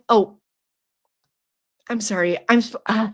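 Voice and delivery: usual speaking voice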